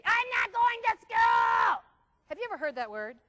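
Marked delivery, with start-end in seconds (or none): 0.0-1.8 much louder, slow whiny shout; a kid shouting his refusal from bed; child refusing. Acted. Child refuses in acted scene.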